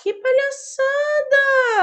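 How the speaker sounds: very high, slow drawn-out scorn; high slow mock exasperation; exasperated. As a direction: drag out the disgust. Disgusted at one checkpoint.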